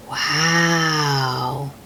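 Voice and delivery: ordinary delivery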